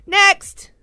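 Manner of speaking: loud, dismissive call. Real, not acted. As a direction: brush it off loudly. Dismissing the joke loudly.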